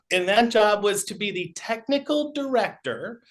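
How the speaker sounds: normal talking voice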